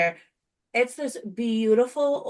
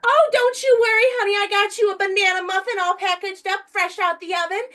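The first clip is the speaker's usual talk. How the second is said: put-on sweet old lady; 10 semitones higher than usual. Retelling a kind customer, high sweet grandma voice. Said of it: "She goes".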